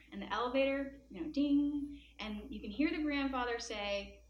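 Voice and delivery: usual speaking voice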